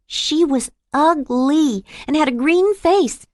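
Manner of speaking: lightly voiced curious little boy.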